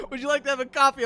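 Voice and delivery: high, loud prim lesson phrase; mock podcast Italian. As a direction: chirp it like a language tape. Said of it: "But it's just like".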